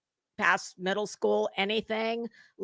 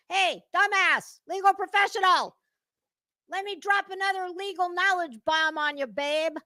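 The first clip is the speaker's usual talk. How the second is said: mocking taunt; 7 semitones higher than usual. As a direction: taunt her like a know-it-all rival. Taunting Betty directly.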